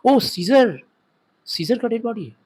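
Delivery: servant stopped short by a corpse, lightly acted; mildly acted shock. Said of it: "देखते ही बोल रहा है". (हल्का अभिनीत सदमा, ऊँचे सुर में)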